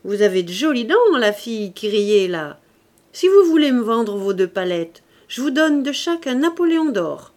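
loud street-hawker's call; exclamation soudaine. A narrator's reading. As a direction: call out to her like a fairground huckster. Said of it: "s'écria tout à coup". (harangue de bateleur, lancée à voix forte)

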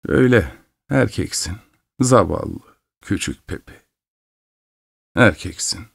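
soft, falling, resigned murmur; sorrowful tenderness. A narrator's reading. Mother to her poor little son, sorrowful and soft. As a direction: let it sink quietly, with pity. Mother sadly accepts son's manhood.

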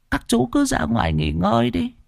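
a hint of a creepy old granny shooing guests out; old woman's voice. Old woman telling the guests to go rest, lightly voiced old woman.

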